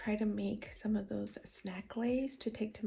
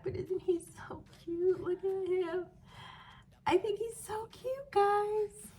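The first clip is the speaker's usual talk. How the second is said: cooing; 9 semitones higher, slower than usual. Real, not acted. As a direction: coo over something adorable. Gushes over her finished crochet capybara.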